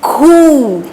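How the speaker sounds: घू with a long, held "oo"; aspirated घू, vowel stretched; syllable demo. Shown as a demonstration. Teacher answering how it is read, घू drawn out. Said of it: "इसको हम क्या पढ़ेंगे".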